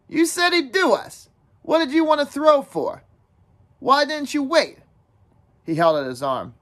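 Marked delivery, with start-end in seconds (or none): 0.1-4.8 put-on high, accusing boyish voice; flushed reproach. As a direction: throw the blame back at him. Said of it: "Jack flushed suddenly".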